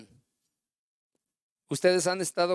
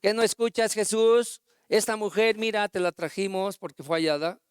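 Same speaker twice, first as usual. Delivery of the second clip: retold shouting; 5 semitones higher than usual. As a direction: bark it at him like an angry crowd. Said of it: "le estaban gritando".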